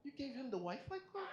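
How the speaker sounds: high-pitched mock-shocked question; hurt disbelief. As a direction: act out the betrayed husband, voice way up. Acted betrayed husband confronting wife.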